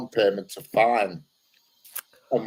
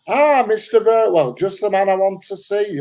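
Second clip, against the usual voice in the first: copper's gleeful greeting; 6 semitones higher than usual. Retold or imitated. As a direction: imitate a copper pleased to catch his man. Copper greeting a wanted man.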